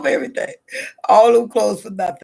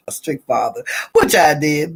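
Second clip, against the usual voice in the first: ordinary talk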